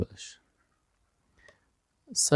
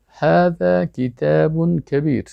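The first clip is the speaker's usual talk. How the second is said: slow, drawn-out Arabic with long vowels, pitched higher; model sentence; 4 semitones higher, much slower than usual. Shown as a demonstration. Teacher showing how sentences are said, slow model reading. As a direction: stretch هذا کتاب کبیر out evenly for learners to copy.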